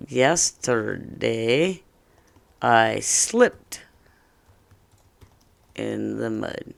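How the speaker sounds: drawn out, word by word, with long pauses; measured, low-key teacher modelling; slow model reading. Shown as a demonstration. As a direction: read it slowly for the class to follow. Models answer slowly for students.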